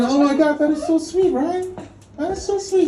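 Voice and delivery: high-pitched doting coo; gushing. Acted. Father reacting to his daughter's German, gushing. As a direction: gush over how adorable it is.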